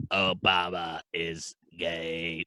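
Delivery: deep, drawn-out alien pronouncement; alien Splurn. Pretend. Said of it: "his name's Splurn".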